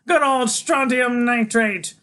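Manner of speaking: high, slow, delighted shout; gleeful kid's loud high cry; Freak. A narrator's reading. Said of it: "he goes".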